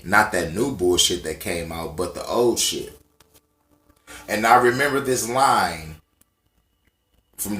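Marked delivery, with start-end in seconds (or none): none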